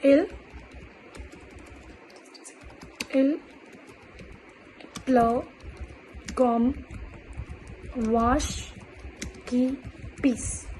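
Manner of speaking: each word set apart with long pauses; slow word-by-word dictation. Shown as a demonstration. Teacher dictating the French idiom word by word. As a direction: dictate it slowly for learners to copy. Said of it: "the first expression I'm going to teach is".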